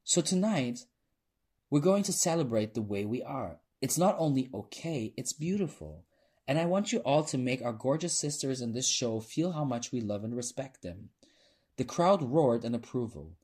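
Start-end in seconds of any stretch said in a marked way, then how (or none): 0.0-11.1 raised, high public address; rallying speech. A narrator's reading. Performer to the club audience, rallying them. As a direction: rouse the room with heartfelt conviction.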